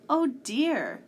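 loud, high cry of dismay; alarmed cat. A narrator's reading. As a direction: exclaim in storybook alarm. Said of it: "The cat blinked in alarm".

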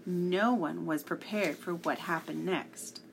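ordinary delivery, nothing marked

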